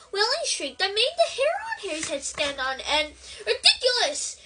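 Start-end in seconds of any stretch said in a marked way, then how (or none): 3.5-4.4 high shout of the spell. A narrator's reading. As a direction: shout the word out. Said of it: "shouted Seamus".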